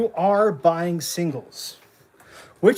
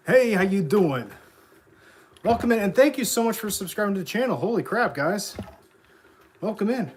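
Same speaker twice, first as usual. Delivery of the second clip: hype streamer intro; 4 semitones higher, faster than usual. Acted. Streamer greets new viewers.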